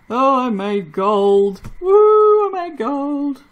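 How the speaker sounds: slow, loud, then a sung high woo; excited cheer. Real, not acted. Soaper celebrating gold soap, loud high sung cheer. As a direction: sing out the win.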